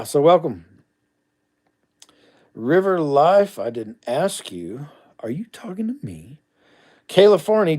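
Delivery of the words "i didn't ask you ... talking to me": teasing whisper. Real, not acted. Teasing aside to a commenter.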